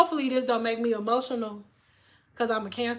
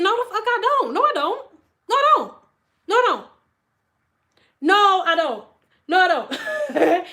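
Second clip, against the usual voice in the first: high, loud, falling refusals; high-pitched comic indignation; mock defiant refusal; 7 semitones higher, 5 dB louder than usual. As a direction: refuse it over and over in a high, punchy voice. Acts out refusing the insult.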